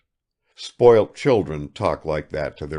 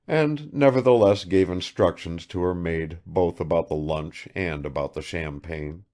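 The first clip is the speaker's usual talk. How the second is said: usual speaking voice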